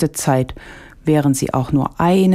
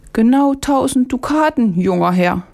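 high-voiced market woman; lilting, high little-old-lady voice; vegetable seller; 9 semitones higher than usual. A narrator's reading. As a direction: play the old market woman, pitched well up.

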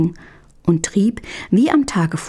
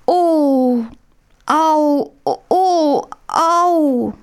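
high, wailing ows and ohs of feigned pain; pitiful moaning; 8 semitones higher, much slower than usual. Acted. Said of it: "ein jämmerliches Stöhnen". (hohes, jämmerliches Stöhnen)

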